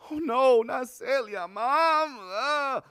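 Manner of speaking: put-on a boy faking sorrow at his mother's news; mock lament. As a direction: overplay the kid's grief for laughs. Child mimics grief, retold.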